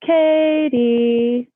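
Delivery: high, lilting call of an unseen ghost; creepy sing-song voice. Retold or imitated. Ghost calling the little girl's name in the bathroom, eerie sing-song. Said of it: "she said it was so sing-songy and terrifying".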